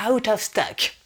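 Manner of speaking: put-on American "out of stock"; American accent. Vlogger mimicking YouTubers' English, American accent. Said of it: "elle partit en américaine".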